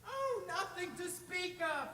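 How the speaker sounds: high, slow airy brush-off; breezy dismissal. Acted. Boy assuring the troll it doesn't hurt, high and airy. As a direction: wave it away innocently.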